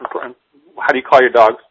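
ordinary delivery

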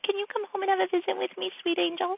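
high, soft cooing; singsong sweet-angel voice; sugary sweet mom. Retold or imitated. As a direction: coax a beloved child tenderly. Voices sweet talk to other daughter.

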